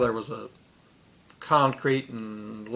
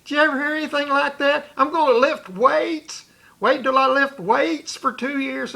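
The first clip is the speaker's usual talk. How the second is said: high, loud mocking parody of the threat; jeering parody of a bully's boast; mocking imitation; 12 semitones higher, 5 dB louder than usual. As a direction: ridicule the tough-guy line in a high sing-song. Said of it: "he said".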